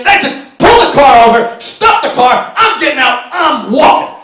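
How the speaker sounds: high, loud furious orders; yelling at his wife to pull the car over; angry demand. Retold or imitated. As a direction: bark at her to stop the car.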